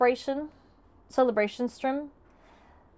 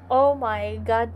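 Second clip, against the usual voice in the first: usual speaking voice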